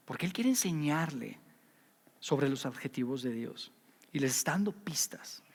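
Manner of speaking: soft, unvoiced confiding whisper. Preacher whispers an aside to the congregation about Jesus' hints. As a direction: let them in on a secret.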